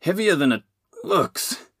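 high, strained huff of effort; puffed, straining voice. A narrator's reading. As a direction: huff it while lifting a weight. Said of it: "he huffed".

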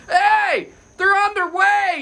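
high, loud imitation of a guard hollering; retold long-distance call. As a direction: shout news across the street, stretched out. Guard calls across the street.

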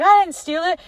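high-pitched mock protest; imitated defensive comeback; indignant denial. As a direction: protest the accusation, pitch jumping up. Retold quarrel, indignant denial.

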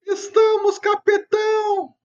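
very high, slow chanting reply; kids show host. Pretend. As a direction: answer like an eager kid.

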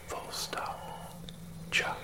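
punctuation breathed in a whisper; whispered dictation. Acted. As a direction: whisper the punctuation marks aside. Reading punctuation aloud.

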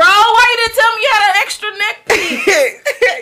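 high, slow and giggling; laughing while talking. Retold or imitated. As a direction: talk through the laughter. Mock scolding the date.

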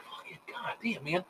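hushed cursing under the breath; whispered grumble. Retold or imitated. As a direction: curse it quietly to yourself, almost voiceless. Muttering to himself about lights.

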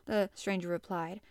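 ordinary delivery, nothing marked

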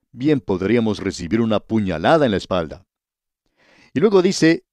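ordinary talk

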